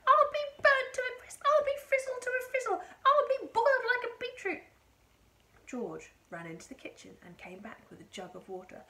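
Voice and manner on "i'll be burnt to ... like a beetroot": high, frantic wailing shout; yelling. A narrator's reading. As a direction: screech each disaster at full volume. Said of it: "she yelled".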